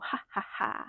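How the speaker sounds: soft breathy "ha ha ha" witch laugh; a quiet witchy chuckle. Pretend. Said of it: "better witch laugh than that chuckle or cackle".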